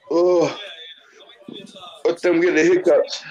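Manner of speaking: usual speaking voice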